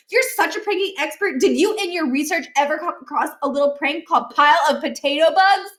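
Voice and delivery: put-on an offended kid shouting his achievements. A narrator's reading. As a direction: rant about past glories. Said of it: "Miles was shouting now".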